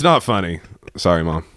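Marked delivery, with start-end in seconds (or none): none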